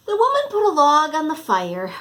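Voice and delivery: usual speaking voice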